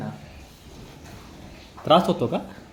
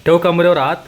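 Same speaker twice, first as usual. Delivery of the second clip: louder, cheering instruction; loud coaching; 4 semitones higher, 7 dB louder than usual. Real, not acted. Coaching child's dance.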